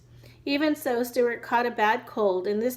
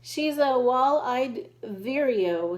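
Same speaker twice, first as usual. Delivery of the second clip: slow, know-it-all expert voice; scientific tone; 2 semitones higher, slower than usual. Acted. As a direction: announce it like a scientist. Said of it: "said George scientifically".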